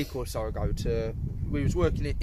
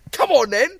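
loud, high-pitched goading shout; acted fight challenge; 8 semitones higher, 10 dB louder than usual. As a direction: imitate a bloke calling someone out. Acting out bloke inviting fight.

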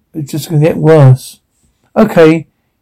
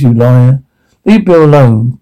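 ordinary delivery

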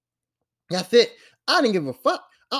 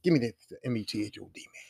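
deflated mutter; 9 semitones lower, 10 dB quieter, faster than usual. Retold or imitated. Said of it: "I'm just like".

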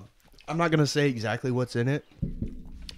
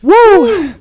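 loud whooping yell from the heat; high loud woo; 14 dB louder than usual. Real, not acted. Reacting to spicy wing.

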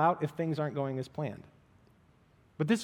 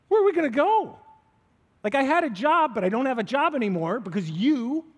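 high, loud disbelieving outburst; incredulous; 8 semitones higher, 5 dB louder than usual. Retold or imitated. Peter to Jesus, incredulous and loud. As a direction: throw it back at him in disbelief. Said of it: "I think incredulously".

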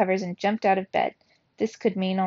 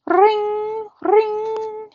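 high, bright ringing voice like a phone; high mock telephone ring; imitated phone ring; 7 dB louder than usual. A narrator's reading.

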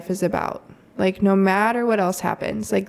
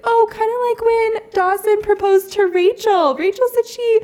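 high, bright imitation of her four-year-old; little girl voice; 13 semitones higher, 4 dB louder than usual. Mom imitating her daughter explaining tears of joy, high child voice. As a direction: pitch up into a chirpy child.

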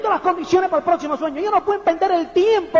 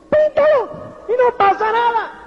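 much higher and louder, a cheerful "Píntalo" to the mechanic; breezy command; 8 semitones higher, 3 dB louder than usual. Retold or imitated. The speaker telling the garage to repaint, loud and carefree. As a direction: toss off the order, high and untroubled. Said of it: "lo llevo y le digo".

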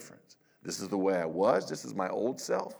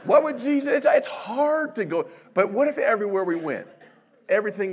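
laughing; 12 semitones higher, 10 dB louder than usual. Real, not acted. Laughing burst mid-sermon.

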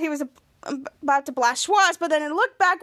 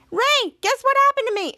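eager high voice; 9 semitones higher than usual. Retold or imitated. Charlotte eager to tell.

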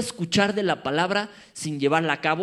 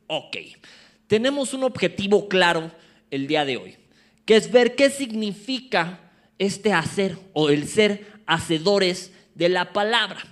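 usual speaking voice

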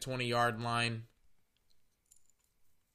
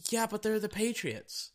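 mocking fan; 8 semitones higher than usual. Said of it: "And it's like".

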